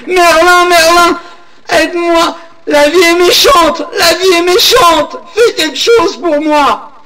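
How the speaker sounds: put-on high, loud, wailing old woman pleading through sobs; crying old woman. Old woman clutching Merlin's cape, begging him, in a high crying wail.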